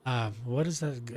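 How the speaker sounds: ordinary talk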